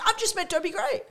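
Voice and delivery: high, gushing retold boast; excited fan. Lou telling the newcomers about his idol, excited. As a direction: blurt it high, bursting to tell. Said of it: "he's so excited, he's telling everyone".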